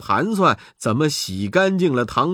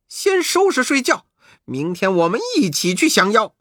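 higher lively monkey voice; Monkey King; 5 semitones higher than usual. Acted. Monkey King telling his brothers to rest. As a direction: order the brothers to bed. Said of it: "悟空说".

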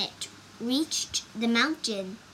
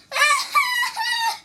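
very high, loud pained wail; cry of pain; 7 dB louder than usual. A narrator's reading. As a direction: shriek in pulses from a stabbing pain. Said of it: "cried the monkey".

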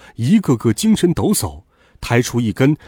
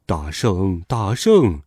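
lower, softened pleading of a minor god; anxious explaining; 5 semitones lower, slower than usual. Acted. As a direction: excuse yourself humbly to a superior. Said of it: "徒弟忙辩解说".

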